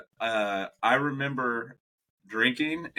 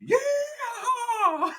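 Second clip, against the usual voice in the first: high, squealing yeehaw; kid's yeehaw. Retold or imitated. Toddler yelling yeehaw at the restaurant, very high and excited. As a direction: yell it like a thrilled toddler.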